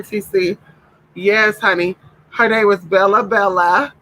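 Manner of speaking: usual speaking voice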